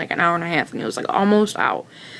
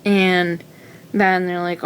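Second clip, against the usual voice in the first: ordinary talk, nothing marked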